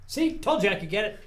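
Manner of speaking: high, loud gloating; triumphant. Real, not acted. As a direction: crow about it proudly. Triumphant after grabbing bandolier.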